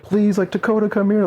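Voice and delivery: high, pleading mock cry for the lost dog; imitating crying girls. Little girls calling for their runaway dog, high mock crying plea. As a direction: play the little girls calling their puppy back, a touch tearful. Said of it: "they're just crying".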